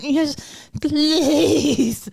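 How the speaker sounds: high, forced out, struggling; the word won't come out; strained teen please. Retold or imitated. Teen forces out yes please to mum, strained and high. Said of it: "She went, like, trying to say it".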